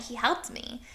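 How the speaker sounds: ordinary delivery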